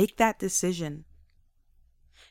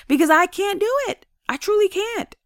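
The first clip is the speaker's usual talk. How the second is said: emphatic admission; 12 semitones higher, 4 dB louder than usual. Real, not acted. Emphatic confession.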